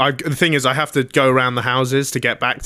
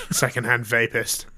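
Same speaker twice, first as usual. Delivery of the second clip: disdainful repeat; 5 dB quieter than usual. Real, not acted. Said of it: "I don't love that phrase".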